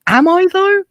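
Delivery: very high, teasing comeback question; cheeky challenge. Retold or imitated. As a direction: question it back in a squeaky mock doubt. Cheeky comeback to being called British.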